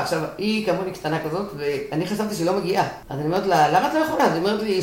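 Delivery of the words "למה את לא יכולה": incredulous question. Retold or imitated. Retelling her frantic question to friend.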